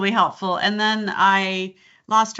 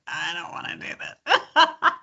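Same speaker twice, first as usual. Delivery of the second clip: slow, higher, half-laughing protest; mock whine; 7 semitones higher than usual. Coach recalling her dread of that job, mock whine. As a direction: drag out a reluctant mock complaint.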